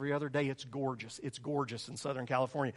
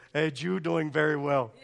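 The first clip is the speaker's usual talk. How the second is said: put-on Puerto Rican accent; 7 dB louder than usual. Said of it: "She's from Puerto Rico".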